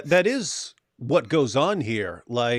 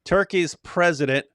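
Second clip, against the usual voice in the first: ordinary delivery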